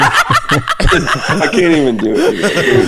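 high, slow words through laughter; helpless laughter. Real, not acted. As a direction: try to talk while cracking up. Cracks up trying the impression.

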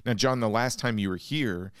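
usual speaking voice

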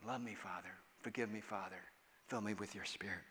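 hushed, low plea to the Father; tender quiet prayer; soft prayer. Shown as a demonstration. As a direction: pray it gently under the breath. Whispered prayer after okay.

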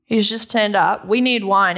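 grumpy servants. Retold or imitated. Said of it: "How grumpy would you be".

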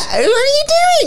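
very high, loud mock greeting through laughter; Senko's voice. Mocking the Senko's greeting to a child, very high and laughing. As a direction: lampoon the Senko's grating hello. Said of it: "It's 'cause of her voice".

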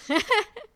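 a short high burst of laughter; laughing. Real, not acted. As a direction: laugh at your own silliness. Laughing at own story. (忍不住笑出声)

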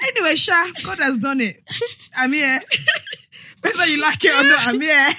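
loud, laughing declaration; laughing triumph. Real, not acted. Guest announces her arrival on the podcast, laughing and triumphant. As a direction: declare it loudly through laughter.

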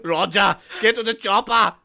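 loud, high action-hero command; Arnold Schwarzenegger. Acted. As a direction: urgently order your partner, hammed-up. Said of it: "Arnold Schwarzenegger and Roger Moore".

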